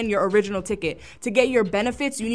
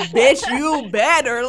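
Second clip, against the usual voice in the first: sassy triumph; 3 semitones higher, 5 dB louder, slower than usual. Retold or imitated. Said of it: "walked down them stairs like".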